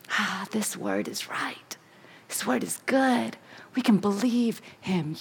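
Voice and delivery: hushed whispered persuasion. Acted. Eve whispering to her husband about the fruit. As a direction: whisper it like a secret.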